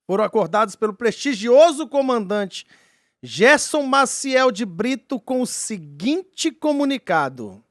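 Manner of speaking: dramatic slow storyteller voice; story narration. Acted. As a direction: narrate it like a mystery documentary. S0 narrates the UFO case.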